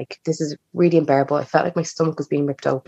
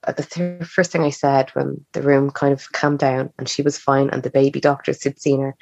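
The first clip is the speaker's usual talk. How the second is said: ordinary delivery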